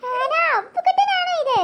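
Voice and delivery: high, slow, drawn-out cooing; baby talk. Acted. Elder sister meeting the baby, cooing baby talk. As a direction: dote on the baby.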